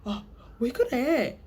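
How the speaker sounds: high, quick excited Korean reaction words; K-drama fan reacting. Fan switching into Korean mid-chat, excited. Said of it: "I'll be like".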